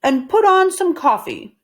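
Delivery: Mister Rogers voiced. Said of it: "said Mister Rogers".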